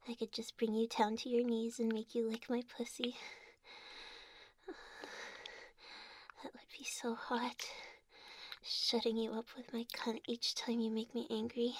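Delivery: soft, breathy teasing whisper. Acted. Intimate teasing whisper to partner.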